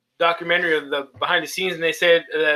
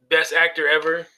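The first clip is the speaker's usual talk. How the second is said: ordinary talk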